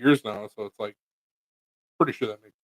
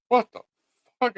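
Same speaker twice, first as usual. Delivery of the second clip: shocked; 10 semitones higher than usual. Retold or imitated. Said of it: "they're gonna be like".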